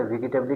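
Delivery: वेजिटेबली said as "vi-gi-teb-lee", hard g; वेजिटेबली with hard g and "-lee" ending; mispronounced "vegetable". Shown as a demonstration. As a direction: imitate someone misreading वेजिटेबली as "vigi-tebli". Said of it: "कोई कहता है".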